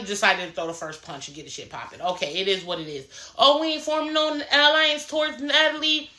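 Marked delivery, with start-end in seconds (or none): 3.4-6.1 high-pitched mocking put-on voice; mocking imitation. Castmate mocking another over forming an alliance, high mocking imitation. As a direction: mimic her in a high, silly voice to make fun of her. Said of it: "Diamond was mocking Dolly".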